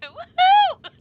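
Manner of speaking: very high, very loud whoop; cheering whoop. Acted. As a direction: let out a wild cheer. Cheering in flight.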